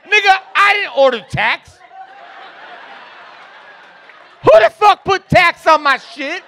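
loud, high, indignant yelling; very loud outraged customer. Acted. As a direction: yell it in disbelief, way up high.

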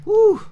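a very high, loud woo of praise; joyful woo shout. Real, not acted. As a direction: let out a burst of joy. Overjoyed preacher's outburst.

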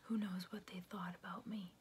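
softly whispered; whispered aside. Real, not acted. Telling a friend about her panic, whispered aside. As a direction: breathe the words, barely voiced.

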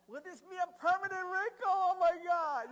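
high, very loud horrified wail; mock panic. Preacher mocking people worried about wrinkles, loud mock panic. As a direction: wail it in exaggerated alarm.

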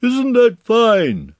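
high, slow, loud call; shouting down. A narrator's reading. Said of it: "shouted Winnie the Pooh down to you".

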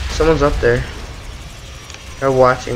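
normal talking voice

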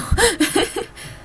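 a high, squeaky laugh; high giggle. Real, not acted. Player laughing at calling herself a loser, high giggle. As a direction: giggle at your own joke.

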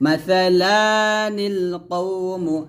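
মাসালানিল কওমু drawn out, one alif length on লা; slow recitation. Shown as a demonstration. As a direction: recite মাসালানিল কওমু slowly with noon qutni. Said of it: "এরকম লাতে আমরা এক লিপ টান দিব". (ধীরে টেনে তিলাওয়াত)

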